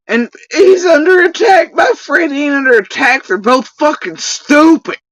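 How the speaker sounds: high sarcastic yell at the critics; mocking shout. Real, not acted. Sarcastic outburst about them.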